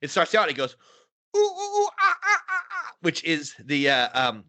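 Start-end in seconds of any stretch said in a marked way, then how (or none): 1.4-3.0 very high whooping ooh ah cries; kookaburra call. Retold or imitated. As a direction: whoop it high like a jungle bird. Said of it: "he's basically doing the kookaburra sound".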